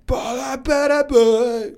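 high, loud mock chatter, drawn out slowly; imitating his own booming phone voice; loud phone babble. Co-host mocks how loudly he talks on the phone. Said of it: "I'm like".

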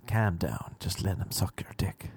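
low and soft, dropping to a whisper; quietly calm him down as the woman; hushed soothing. Acted. Woman hushes the alarmed visitor.

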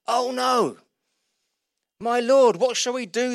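a panicked plea to his master; alarmed servant. Acted. Servant seeing the surrounding army, alarmed cry.

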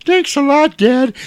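put-on loud, very high child voice; mock kid voice. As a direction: play a sarcastic little kid, way up high. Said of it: "it's his kid that he's seeing".

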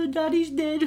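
high, whimpering mock sob; mock whiny crying. Mocking a crybaby king whining about his dead dad. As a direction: whine it like a crybaby.

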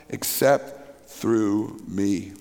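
low, deep and resonant. Real, not acted. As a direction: drop the voice deep. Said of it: "I can say things in a deeper voice".

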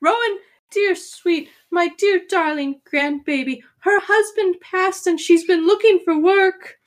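put-on high, squeaky old gnome woman; tiny granny voice; little old grandma voice.

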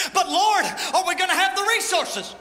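high, loud, anxious plea; pleading church. Acted. Church crying out to God about where to go, high and loud. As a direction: cry it out up to heaven, worried.

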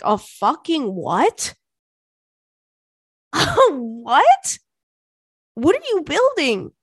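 high, stretched-out disbelief; mock-baffled, voice shooting up on the question; incredulous retort. Daughter asked by dad about a wood store, incredulous.

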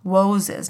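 "Woses" with a W sound in place of R; W instead of R in "Woses"; says w for r. Retold or imitated. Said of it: "I couldn't say my R's".

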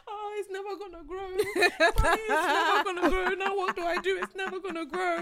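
mock child panicking to mummy; sister in a fit. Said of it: "My sister had a fit, started crying".

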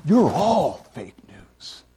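slow, weighty verdict from God; a hint of God pronouncing judgment, very slow; voicing God. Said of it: "'Cause God said".

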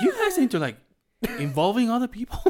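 pry like a nosy lady; high mock-curious stranger. Mall lady's nosy question.

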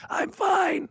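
high, cracking voice. Retold or imitated. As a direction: voice breaks on the words. Voice cracks telling wife he's fine.

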